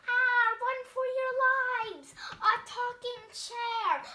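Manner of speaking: loud, high panicked cry; terrified yell; panicked shout. Acted. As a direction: scream to warn everyone to flee. Animals flee talking chair.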